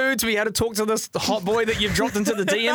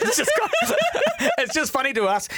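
laughing; 13 semitones higher than usual. Real, not acted. Laughing about their own silliness.

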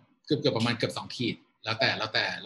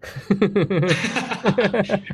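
a real, high burst of laughter; laughing; 7 semitones higher, 6 dB louder than usual. Real, not acted. Guest laughing at the host's jab, loud real laughter.

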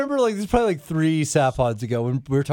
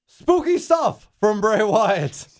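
announcer voice; 4 semitones higher, 3 dB louder, much slower than usual. Retold or imitated. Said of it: "he's like".

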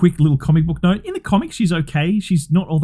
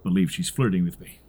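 deadpan, lower and quieter; matter-of-fact Drax; 9 semitones lower, 6 dB quieter than usual. Retold or imitated. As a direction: state it flatly as Drax. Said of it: "he says it so matter-of-factly".

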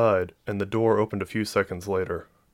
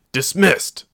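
loud, raised angry command; 8 semitones higher, 6 dB louder than usual. A narrator's reading. Sergeant ordering the pilot away, angry. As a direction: dismiss sharply, voice raised. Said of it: "the anger in his voice".

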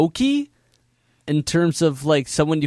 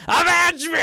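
villain shout; 13 semitones higher than usual. Retold or imitated. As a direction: explode Avenge me as a high villain shout. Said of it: "Even the scene where it's like".

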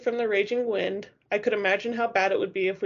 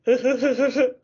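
loud, higher, juddering shiver on one word; chattering stutter; 4 semitones higher, 6 dB louder than usual. A narrator's reading. Freezing girl trying to get words out, stuttering.

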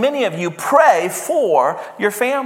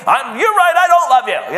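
raised voice, much higher pitch; mock angry retort; 7 semitones higher, 4 dB louder than usual. Husband answering his wife when confronted, mock exasperated outburst. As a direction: play-act snapping back in a quarrel. Acted retort in marital quarrel.

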